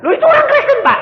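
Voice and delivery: loud, very high mock outcry; indignant protest. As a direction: protest incredulously to the pastor. Acted congregant protesting to pastor. (protes heboh bernada tinggi)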